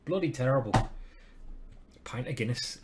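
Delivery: a deliberately poor Irish accent on "Bloody terrible"; bad Irish accent. Shown as a demonstration. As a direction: ham up a weak Irish accent, self-mocking. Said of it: "I hope my Irish accent was better".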